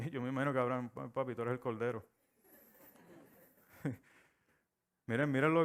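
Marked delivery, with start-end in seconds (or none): none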